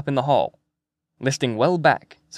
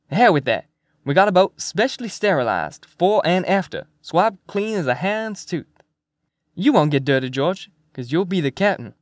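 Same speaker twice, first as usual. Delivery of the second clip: higher, emphatic sales pitch to a reluctant captain; McMurphy, hearty persuasion; 5 semitones higher, 3 dB louder than usual. Acted. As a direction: brush off the worry and talk him into it.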